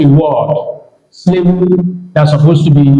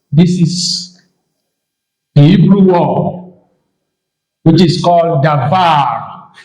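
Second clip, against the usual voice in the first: ordinary delivery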